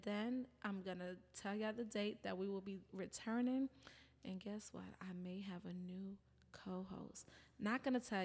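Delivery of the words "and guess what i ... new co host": hushed, low and half-whispered; secretive tease. Real, not acted. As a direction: drop your voice to share a secret, slowing down. Teasing a secret co-host reveal.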